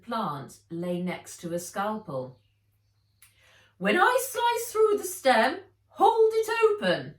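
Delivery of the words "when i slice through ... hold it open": much higher, louder instruction; brisk instruction. A narrator's reading. Fred directing Nat during dissection, high and loud. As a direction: give the order like a surgeon.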